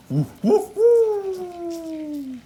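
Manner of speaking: high, loud ghostly woo. Shown as a demonstration. Roger showing Wendy how ghosts go, ghostly woo. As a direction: moan like a spooky ghost. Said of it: "That's how ghosts go".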